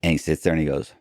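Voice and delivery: ordinary talk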